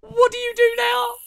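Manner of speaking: very high excited gloat; giddy triumphant taunt; gleeful gloating. Real, not acted. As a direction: crow at the neighbor. Gloating after heist.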